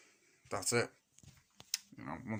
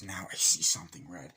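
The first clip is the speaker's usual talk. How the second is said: low killer voice; menacing Ghostface; 4 semitones lower, 4 dB louder than usual. Retold or imitated. Ghostface taunting the victim before stabbing, low menace. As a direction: growl it as the masked killer.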